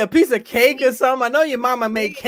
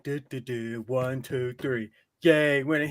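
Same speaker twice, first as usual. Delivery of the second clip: lower, softer dumb counting; dopey ref impression; 8 semitones lower, 7 dB quieter than usual. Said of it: "be a ring post like".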